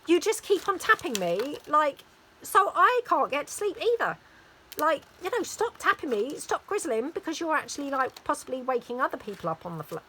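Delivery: lightly acted fed-up mum telling off her child; mild exasperation. Mum to daughter who keeps tapping her, mildly exasperated.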